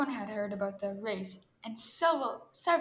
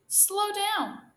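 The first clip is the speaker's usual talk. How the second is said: high, drawn cry of protest; 10 semitones higher, 4 dB louder than usual. A narrator's reading. Turtle to greedy hare wolfing cabbages, crying out. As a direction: call out to slow someone, pitched high. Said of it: "cried Muggs".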